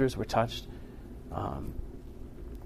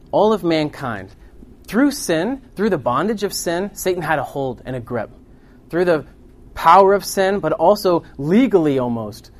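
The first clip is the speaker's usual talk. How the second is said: high, loud pulpit emphasis; forceful preaching; 4 semitones higher, 8 dB louder than usual. Real, not acted. Preacher on sin's grip on mankind, forceful preaching. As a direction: drive each phrase home to the congregation. Preacher builds on bondage and freedom.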